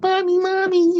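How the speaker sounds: put-on very high childish mommy; like a little kid. Son acting like a little kid around his mom, high and childish. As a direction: call out like a bouncing toddler. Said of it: "I go around her like, like a little kid".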